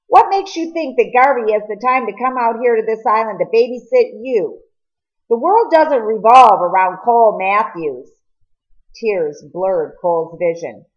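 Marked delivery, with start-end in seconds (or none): 0.1-8.1 louder, cutting retort; sharp rebuke. Acted. Edwin scolding Cole for his self-focus, loud and sharp. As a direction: cut him down to size, voice raised.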